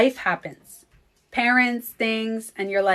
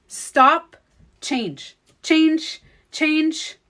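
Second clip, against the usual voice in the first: loud, high repeated "Change"; insistent mantra; 6 semitones higher, 4 dB louder, much slower than usual. Shown as a demonstration. She repeats her mantra to viewers, insistently. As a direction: drill it into yourself. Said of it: "repeating mantra demo".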